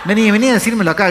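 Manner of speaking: fast, high, loud challenge; retold shout. Man daring another moviegoer to come over, fast mock shout. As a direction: imitate a man yelling for a fight. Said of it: "gritó el otro".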